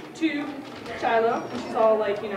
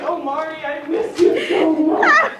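loud, drawn-out fake wail; exaggerated lovelorn lament; mock grieving; 3 semitones higher, 10 dB louder, slower than usual. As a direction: wail it hammily, close to laughing. Imitates widower wailing, then laughs.

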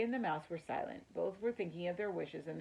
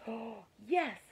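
a high, loud, gasping Oh, yes; excited; 6 semitones higher, 5 dB louder than usual. Acted. Mouse hears of a perfect garage, excited cry. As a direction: burst out with sudden delight. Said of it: "Ralph was excited".

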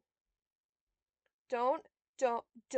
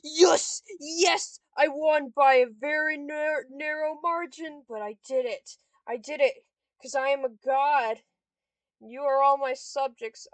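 loud, exultant victory cry; triumphant shout; 12 dB louder than usual. Real, not acted. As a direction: crow over your win. Victory celebration.

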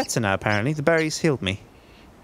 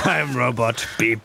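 slow, low robot talk; slow clunky robot voice; toy robot voice; 4 semitones lower, slower than usual. Pretend. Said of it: "your best robot voice".

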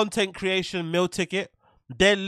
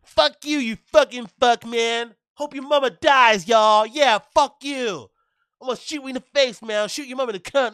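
put-on higher, louder raging gamer kid; Call of Duty lobby kid; 6 semitones higher, 4 dB louder than usual. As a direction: rage like a kid in an online lobby. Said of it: "Those Call of Duty lobby guys".

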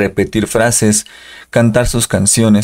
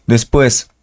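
ordinary talk, nothing marked

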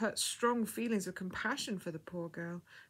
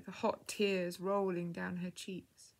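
plain reading voice

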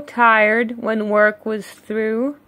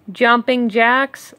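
plain reading voice

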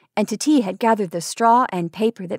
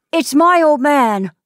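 put-on high, loud, delighted child; happy exclamation, voice lifted; joyful cry; 6 semitones higher, 6 dB louder than usual. A narrator's reading. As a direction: cry out with a boy's joy. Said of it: "cried Tati joyfully".

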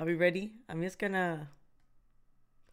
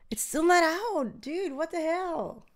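drawn-out, high-pitched whine of disbelief; exasperated outcry; 8 semitones higher, slower than usual. Real, not acted. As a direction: whine it slowly in real frustration. Frustrated that update hasn't appeared.

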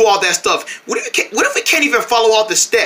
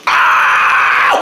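crying scream; 3 dB louder than usual. Acted.

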